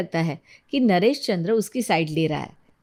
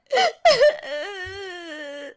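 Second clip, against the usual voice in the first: high, drawn-out mock wail; exaggerated boo-hoo trailing on; fake sobbing; 10 semitones higher than usual. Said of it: "झूठ मूठ रोती हुई". (झूठ-मूठ का रोना)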